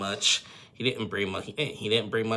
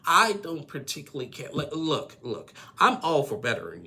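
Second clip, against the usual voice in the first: emphatic; 7 semitones higher, 5 dB louder than usual. Real, not acted. Heated opinion.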